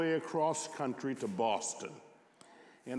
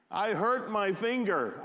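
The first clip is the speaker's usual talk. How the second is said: put-on higher-pitched, hurt little boy; mild childlike wail; 6 semitones higher than usual. A narrator's reading. Small boy tells the operator about his finger, mild wail. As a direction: wail it lightly, as a narrated child. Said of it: "I wailed into the phone".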